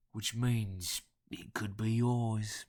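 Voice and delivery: very slow, drawn-out Cockney; guttural nasal Cockney. A narrator's reading. Caller tempting the collector, in slow guttural Cockney. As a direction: dangle the offer slowly in rough Cockney. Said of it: "guttural yet nasal Cockney accent".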